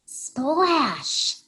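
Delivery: a high, voiced splash effect; splash sound. Reading to kids as Hedgehog dives, high splash sound. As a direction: make the splash as she dives.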